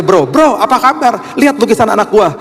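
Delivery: high, lively, chummy greeting; hailing a friend. Retold or imitated. Father eagerly showing a friend the painting, excited high hail. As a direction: call out to a buddy, pitched well up.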